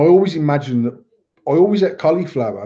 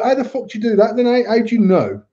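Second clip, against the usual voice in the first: incredulous retort; 7 semitones higher than usual. Retold or imitated. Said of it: "And I was like".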